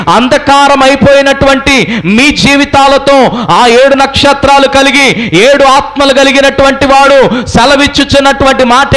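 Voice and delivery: high, sustained sermon cry; preaching at full force. Real, not acted. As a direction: declaim it to the congregation in long high-pitched phrases. (గట్టి స్వరంతో ప్రసంగం)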